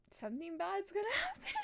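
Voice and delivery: high, loud, fast rising alarm breaking into a laugh; laughing dread. Real, not acted. Player sensing trouble in the story, high laughing dread. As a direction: wail the bad feeling, half laughing.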